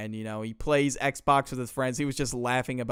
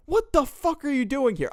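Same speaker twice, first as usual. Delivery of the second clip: startled; 12 semitones higher than usual. Retold or imitated. Michael startled at night.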